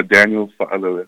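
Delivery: Fa'alele said smooth and quick, vowels run together; careful name demo. Shown as a demonstration. Player says his own name for the host. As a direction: say Daniel Fa'alele the way its owner does. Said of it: "Can you say it for me one more time".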